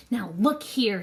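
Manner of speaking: plain reading voice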